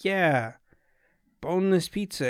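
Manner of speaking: imitating a baffled, halting reply; lost for words. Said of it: "lost for words 'cause she'd never heard".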